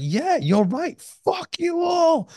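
high, drawn-out fuck you all; fully side with the anger, stretch it out; defiant agreement. Retold or imitated. Retelling finally siding with his angry inner part, high slow defiance.

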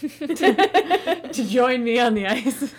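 loud, laughing through the words; real laughter. Real, not acted. Cracking up at own story.

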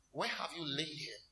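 soft, low mock-solemn question; hushed imitation of a composed preacher; Pastor Chris. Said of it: "like how Pastor Chris would do it".

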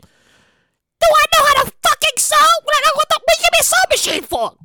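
high, indignant mother voice; mock outraged mom. Son mimicking his mom offended by a sewing machine gift. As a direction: squeak up in disbelief as mom.

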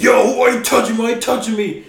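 high, loud, fast indignant yell; mock outraged high voice; angry coworker. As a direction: squeak it out like an offended tough guy. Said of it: "He's like".

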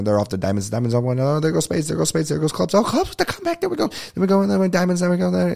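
fast, high, excited auctioneer patter; auctioneer call. Retold or imitated. Calling the race for friends like a fast, high auctioneer. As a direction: rattle it off like a horse-race caller, pitch climbing. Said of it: "That's how they talk, like a ... Auctioneer".

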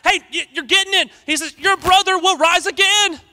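loud, high, fervent preaching; full-force preaching. Acted. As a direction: preach it out with fire to the church.

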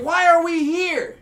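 high, loud, slow yell; outraged yell. Retold or imitated. Marbury demanding why they came, in a high, loud, slow yell. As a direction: demand an answer. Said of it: "He's like".

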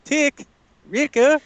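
a light high-pitched Omega impression; high mimicking voice. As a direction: mimic the kid's high little voice. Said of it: "if she can call them Tick and Ricka".

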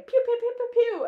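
high, loud playful pew-pew gun noises; imitated gunfire. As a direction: fire off cartoon gunshots by mouth. Imitates guns in film shootout.

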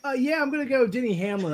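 throat still tight from coughing; recovering from a choking fit; strained after choking. Real, not acted. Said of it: "go into a choking fit".

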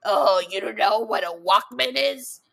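lightly voiced grumpy old timer; a hint of a scolding elder; back in my day rant. Mock old person rant.

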